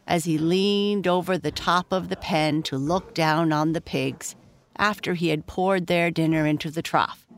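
plain reading voice

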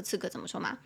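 usual speaking voice

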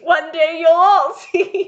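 high, loud melodramatic vow; mock grandiose cry. Comedian vowing to doubting co-host, mock grandiose cry. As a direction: cry it out like a wronged genius.

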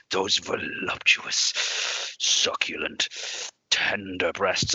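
slow, breathy leering drool over each word; slow lecherous Vince. Retold or imitated. Mocking Vince's leering promo, slow and lecherous. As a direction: savour every word like a dirty old man. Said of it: "but he's like".

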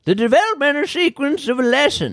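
high, hammed-up old geezer; old man voice. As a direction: croak it like a cranky old-timer. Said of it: "say that in your old man voice".

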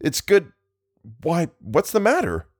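alarmed. A narrator's reading. Friend suddenly collapses.

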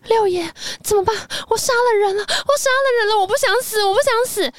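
high, crying panic; terrified, clinging to the sixth prince; panicked. Retold or imitated. Yulan to the sixth prince after the killing, high crying panic.

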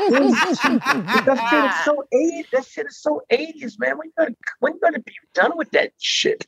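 imitating Wendy Williams. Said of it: "he's like".

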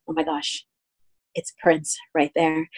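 retold hushed, starstruck aside; imitating a discreet starstruck whisper-to-a-friend; hushed awe. Cousin spots a star nearby.

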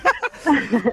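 high bright burst of laughter; delighted laugh. Real, not acted. Delighted laugh after aromat.

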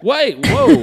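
guard's voice jumps way up in panic; high alarmed whoa. Retold or imitated. Guard at the FBI gate calls after the leaving tech, high startled whoa.

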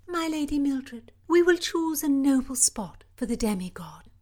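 lightly voiced court lady; hint of a courtly lady; lady-in-waiting voice.

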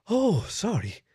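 breathless, shaky apology; trembling. A narrator's reading. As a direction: barely get it out, still trembling. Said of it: "she managed, still trembling".

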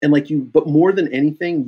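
ordinary talk

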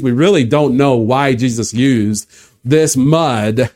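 ordinary talk, nothing marked

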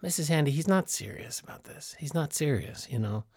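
hushed aside. Acted. Talking to Missus Handy behind Fisty's back, in a soft low murmur. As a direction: murmur it so he can't hear.

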